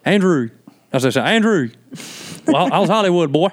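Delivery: put-on rural North Carolina accent. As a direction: imitate Southern relatives hailing a cousin. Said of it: "That's how the, the family talks".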